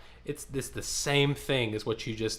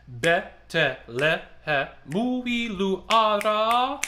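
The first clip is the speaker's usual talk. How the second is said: slow, higher, rhythmic speaking of the line; 5 semitones higher than usual. Shown as a demonstration. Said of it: "And speak".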